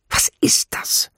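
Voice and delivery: slow, irritated whisper; hushed, vexed question; annoyed whisper. A narrator's reading. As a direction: whisper it crossly. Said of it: "flüsterte sie. Es klang ärgerlich".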